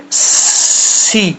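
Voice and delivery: سی with a long hissed s before the vowel; demonstrative, drawn-out سی; whistling seen. Shown as a demonstration. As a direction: hold the sss, then release into سی. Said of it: "تھوڑا سا gap رکھ کے پڑھنا ہے".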